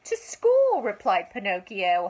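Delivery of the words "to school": put-on bright boy's reply. A narrator's reading. Pinocchio answers the fox.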